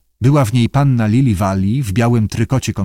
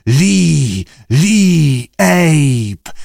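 long, higher creature calls; sea creature voice; 4 semitones higher, 3 dB louder, much slower than usual. Acted. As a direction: parrot the names in drawn-out creature cries. Stwory powtarzają zasłyszane imiona. (przeciągłe wołanie morskiego stwora)

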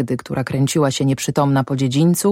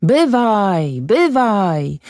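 high, long falling call; a drawn-out cry without full volume; 4 semitones higher, much slower than usual. A narrator's reading. Shouts from across the river, drawn-out high call. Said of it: "rozległy się krzyki".

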